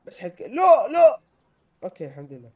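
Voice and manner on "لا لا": high, very loud cry; startled high-pitched outburst; panicked shout. Real, not acted. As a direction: yell in sudden alarm. Nearly falls in game.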